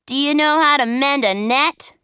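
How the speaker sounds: a lightly voiced fisherman asking. A narrator's reading. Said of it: "he said".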